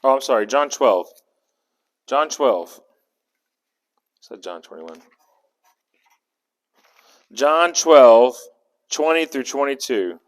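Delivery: usual speaking voice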